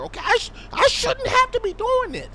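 ordinary delivery

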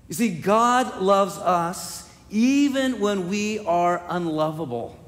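rising from steady to high, loud preaching; a preacher driving home God's relentless love, growing to a shout; building preaching. Acted.

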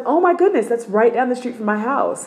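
excited friend. Retold or imitated. Rosie's excited reply.

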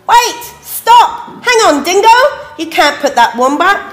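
high, loud, alarmed interruption; urgent protest. A narrator's reading. Animals stopping Dingo from cooking the wombat, urgent. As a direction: jump in to halt him, easing slightly by the end.

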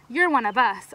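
mild narrated shout. A narrator's reading. One of the kids welcoming Roberta over, mild shout. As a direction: call it out with restraint. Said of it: "another shouted".

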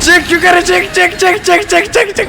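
high, rushed chant of orders; frantic urging. Real, not acted. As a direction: push yourself up the stairs in panic. Fleeing in game, urging. (panikle acele ettiren sesle)

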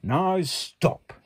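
firm Max. Acted. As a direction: halt the monsters firmly. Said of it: "Max said".

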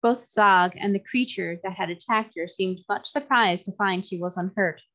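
plain reading voice

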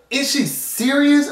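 high, slow, incredulous; stunned disbelief. Real, not acted. Offended viewer asks if grandma is serious in high disbelief. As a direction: ask it like you can't believe your ears.

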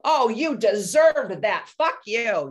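slow mock snarl at the kid; angry retort. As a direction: spit it back at her, slowly. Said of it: "And I was like".

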